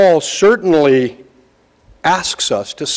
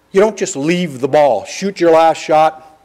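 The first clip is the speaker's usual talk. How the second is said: ordinary delivery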